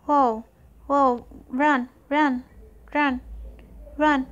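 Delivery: fleeing in a rush, yelling; being chased. Real, not acted.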